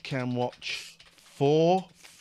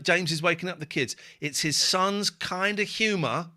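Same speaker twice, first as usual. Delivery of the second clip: ordinary talk, nothing marked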